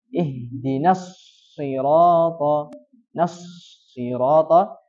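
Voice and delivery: ihdinas sirata with a doubled, held s, slow; correct doubled s. Shown as a demonstration. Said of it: "Yang harusnya dibacanya".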